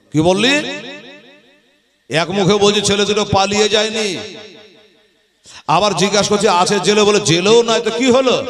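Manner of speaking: a ruler losing his patience; mild mock anger. Ibn Ziyad grows angry. (রাগী শাসকের কণ্ঠ)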